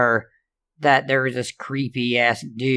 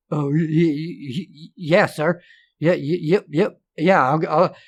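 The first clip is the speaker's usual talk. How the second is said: higher, stuttering, scared yeses; stammering fear; 4 semitones higher than usual. Acted. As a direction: trip over every word, terrified.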